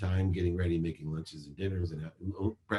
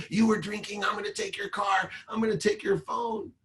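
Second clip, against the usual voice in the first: much higher, mock ranting dad; angry father. Father scolding his daughter over drinking, mock angry rant.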